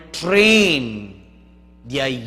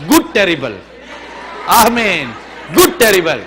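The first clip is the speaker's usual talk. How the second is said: emphatic preaching shout; 3 semitones higher, 8 dB louder than usual. Real, not acted. Preacher exhorts the congregation.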